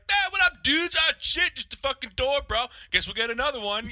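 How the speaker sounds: high, loud laid-back bro voice; chill dad. Retold or imitated. Dad shrugging off the wrecked garage door, easygoing. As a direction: play it totally unbothered. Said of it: "and he'd be like".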